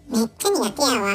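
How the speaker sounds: ordinary delivery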